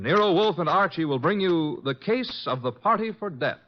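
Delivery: normal talking voice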